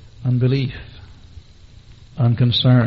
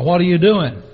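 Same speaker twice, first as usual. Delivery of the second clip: loud official challenge; sharp challenge; 2 semitones higher, 9 dB louder than usual. Retold or imitated. Policeman confronts man passing out tracts, loud challenge. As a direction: confront someone loudly, as a guard would.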